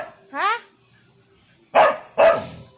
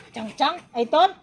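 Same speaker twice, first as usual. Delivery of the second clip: ordinary talk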